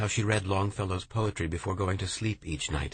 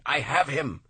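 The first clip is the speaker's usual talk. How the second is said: higher, louder second call of capture; triumphant cry; 7 semitones higher, 3 dB louder than usual. A narrator's reading. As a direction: crow it out again in triumph. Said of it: "Lowell cried".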